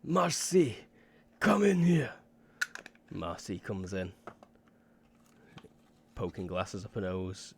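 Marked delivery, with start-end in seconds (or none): 0.0-2.1 high, loud call through the wall. Acted. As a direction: yell for Marcy next door. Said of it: "And she's like".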